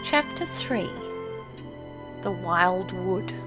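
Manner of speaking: ordinary delivery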